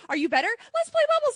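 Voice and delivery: high, loud, gleeful kiddie-car voice; cheery toy car. Acted. Toy car inviting a sick kid to play, high and excited. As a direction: squeal the invitation to a child.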